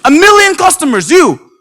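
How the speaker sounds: high, loud disbelieving echo; incredulous echo. Retold or imitated. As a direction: repeat it like you can't believe it.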